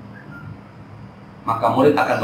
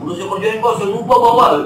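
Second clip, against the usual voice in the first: a student whining an excuse about homework; pleading student excuse; 8 semitones higher than usual. Retold or imitated. (murid merengek beralasan, lebih tinggi dan lambat)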